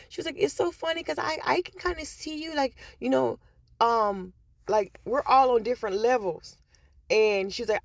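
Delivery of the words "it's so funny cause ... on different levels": much higher put-on coworker voice; imitating coworker. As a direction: quote her in a high light voice. Quoting coworker in high voice.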